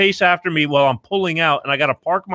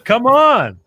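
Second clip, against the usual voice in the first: high, loud coaxing outburst; loud protest; 9 semitones higher, 6 dB louder than usual. Real, not acted. Host urging guest for closing words, loud protest. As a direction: burst out in protest, higher and louder.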